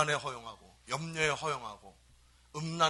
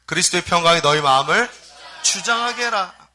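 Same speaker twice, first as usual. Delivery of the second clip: emphatic scripture reading; 16 dB louder than usual. Acted. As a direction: proclaim the verse to the congregation. Announced scripture read aloud.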